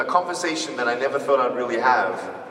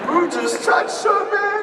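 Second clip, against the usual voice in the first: loud, high, slow mock-furious shout; shouted demand to find the culprit; protective outrage; 6 dB louder, slower than usual. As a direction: bellow it ready to fight. I was ready to headbutt someone.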